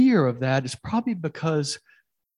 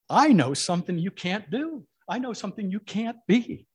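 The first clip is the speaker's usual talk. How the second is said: higher and louder, needling; taunting voice; 7 semitones higher, 6 dB louder than usual. Acted. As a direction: rub it in God's face, rising. Voice taunting God.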